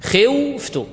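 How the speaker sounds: خوفت stretched slowly with the و kept as a consonant; teacher sounding out خوفت before the vowel is dropped; drawn-out demo word. Shown as a demonstration. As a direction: spell out خوفت slowly and high. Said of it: "كيف يصبح النطق؟".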